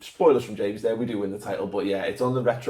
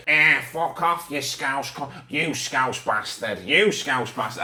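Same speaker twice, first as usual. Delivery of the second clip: hostile United fans; slower than usual. Retold or imitated. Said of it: "they'd be like".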